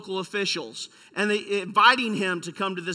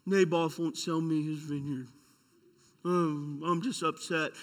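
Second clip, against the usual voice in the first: lower, softer sulky king voice; pouting king; 6 semitones lower, 7 dB quieter than usual. Retold or imitated. Pastor voices King Ahab pouting over the vineyard, low and soft. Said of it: "He goes home pouting".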